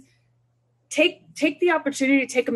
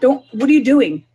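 louder, sharp scolding parent; mock scolding; 3 semitones higher, 7 dB louder than usual. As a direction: call him out as he storms off. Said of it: "stop him and say".

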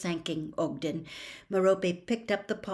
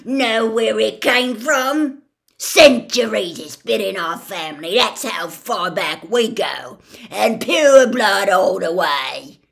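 boastful fury; 3 semitones higher, 13 dB louder than usual. A narrator's reading. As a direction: wave the ring and boast, seething. Gaunt brandishes the family ring.

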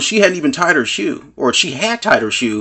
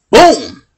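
a loud, higher "boom" for the shove; mock impact boom; 8 semitones higher, 9 dB louder than usual. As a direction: slam the word out like the hit landing. Retold shove, acted impact.

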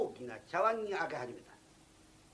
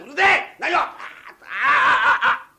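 very loud, higher roaring; furious yell; 8 semitones higher, 18 dB louder, much slower than usual. Acted. Drunk guest roars as the fight breaks out. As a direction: explode in rage. Brawl erupts.

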